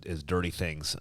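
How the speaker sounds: ordinary delivery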